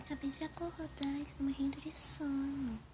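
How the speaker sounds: soft whispered lie to mum; whispering. Pretend. Daughter doll lying to her mother about the hidden friend, whispered. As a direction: whisper it so mum won't notice.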